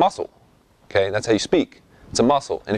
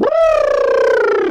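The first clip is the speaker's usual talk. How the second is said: much higher, louder lip trill; singers warming up; 5 dB louder than usual. Retold or imitated. Imitating singers warming up backstage with lip trills. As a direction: buzz the lips in a high loud brr. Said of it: "singers backstage going".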